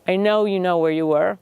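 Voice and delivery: normal talking voice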